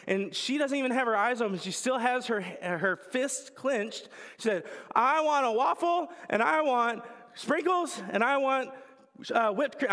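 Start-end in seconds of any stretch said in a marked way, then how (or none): 4.9-9.9 put-on high, loud whiny little-girl demand, slowed; demanding toddler. Toddler with fists clenched demanding waffle, high whine. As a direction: drag out the demand like a stubborn toddler.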